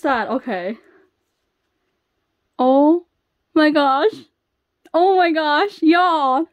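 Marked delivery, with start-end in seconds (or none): 2.6-6.5 loud, high, broken by pauses; stunned excitement. Real, not acted. Fan shows y'all a surprise card, loud stunned excitement. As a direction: let it out in loud amazed bursts.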